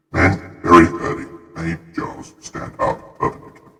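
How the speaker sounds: soft whispered reread; whispering. Shown as a demonstration. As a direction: breathe the words out, barely voiced. Same line reread, hushed.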